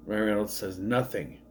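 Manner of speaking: usual speaking voice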